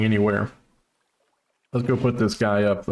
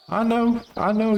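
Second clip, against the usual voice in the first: cutesy high voice; 13 semitones higher than usual. Pretend. Cooing praise to himself.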